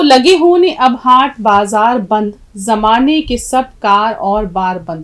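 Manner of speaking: slow, projected verse reading; poem recitation. Acted. Teacher reading the poem to students, slow projected recitation. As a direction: recite the couplet slowly and firmly.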